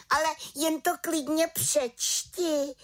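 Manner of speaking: high, slow defiant cry; little witch exclaiming. Acted. Little witch challenges her accusing aunt, high defiant exclamation. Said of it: "vykřikla malá čarodějnice".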